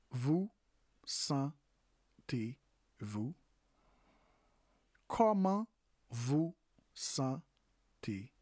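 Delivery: "Comment vous sentez-vous?" stretched, "sentez-vous" drawn out; slow French demo. Shown as a demonstration. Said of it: "In French is".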